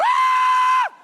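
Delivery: very high, very loud yell; huge scream. Retold or imitated. Dumping the bin off the cliff, huge yell. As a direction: scream as it all goes over the cliff. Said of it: "and go".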